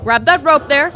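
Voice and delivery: loud, high command; shouted order. Acted. Victor ordering assistants to haul the rope, loud shout. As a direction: bark it across the lab.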